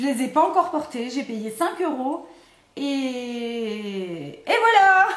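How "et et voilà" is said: very long stretched ET, then a jump to a laughing VOILÀ; comic drawn-out wrap-up. Pretend. Vlogger with nothing more to say, comic drawn-out ending. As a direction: stall slowly, then burst out playfully. Clowning when out of things to say.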